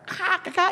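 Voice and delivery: high mock crow caw. Preacher imitating birds descending on the congregation, high cawing. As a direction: squawk it out like a crow.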